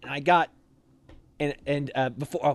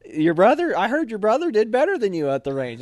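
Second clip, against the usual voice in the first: raised, lively put-on ribbing; imitated teasing officer; 8 semitones higher, 4 dB louder than usual. XO teasing trainee about his brother, imitated lively ribbing. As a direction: needle him like a chummy officer.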